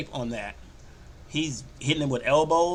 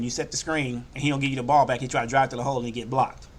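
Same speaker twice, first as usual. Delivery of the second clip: ordinary delivery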